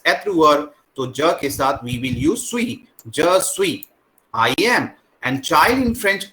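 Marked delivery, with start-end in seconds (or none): none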